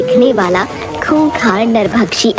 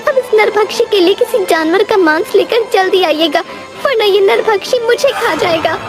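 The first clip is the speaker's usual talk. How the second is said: higher-pitched complaining wife voice; reproachful, worried wife; 10 semitones higher than usual. Acted. Wife blaming her husband's greed, reproachful and worried. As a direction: scold him anxiously, pitched high.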